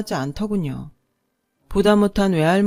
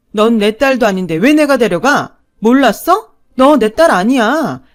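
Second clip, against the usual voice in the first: loud, higher, cutting; cruel shouting; 3 semitones higher, 8 dB louder than usual. A narrator's reading. Stepmother telling the girl she is not her daughter, cruel shout. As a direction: hurl it at the child to wound.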